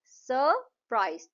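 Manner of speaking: सरप्राइज slow, in two parts, सर, प्राइज; syllable by syllable. Shown as a demonstration. Teacher repeats two claps in सरप्राइज, syllable by syllable. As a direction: pause between both syllables of सरप्राइज. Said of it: "तो दो बार clap होगा".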